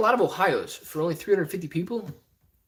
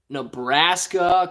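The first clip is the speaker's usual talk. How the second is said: usual speaking voice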